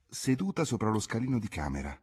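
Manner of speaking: ordinary delivery, nothing marked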